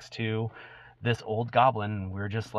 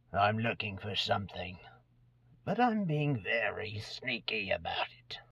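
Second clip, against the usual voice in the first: sneaky whisper; 8 dB quieter than usual. Acted. Sneaky old goblin confides quietly.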